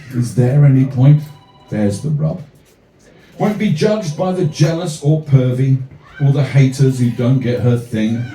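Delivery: measured rhythmic poem reading; poem recital. Acted. Comic poet reading his verse to the crowd, rhythmic recital. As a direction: recite it with a steady, rhyming lilt.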